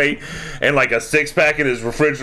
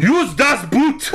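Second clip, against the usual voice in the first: high, slow, bad German accent shout; Arnold; 10 semitones higher, slower than usual. Co-host urges the Germans to flee, mock Arnold accent. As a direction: holler it in a hammed-up Austrian accent. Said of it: "[name], you sounded like Arnold".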